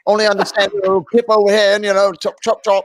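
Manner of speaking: high, fast hammed-up British accent; mock English accent. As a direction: play up a posh English accent, pitched high. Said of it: "really thick English accents".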